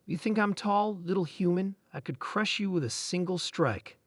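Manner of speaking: ordinary delivery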